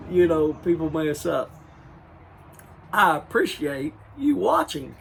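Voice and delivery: loud, laughing through the words. Real, not acted. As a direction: still cracking up. Laughing at own blooper.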